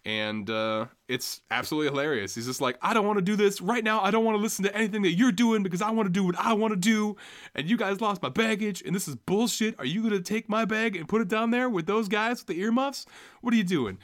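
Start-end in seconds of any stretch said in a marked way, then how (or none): 2.8-14.0 angry outburst. Retold or imitated. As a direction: let it all boil over, pitched way up. Greg loses it at airport staff.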